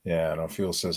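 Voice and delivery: ordinary talk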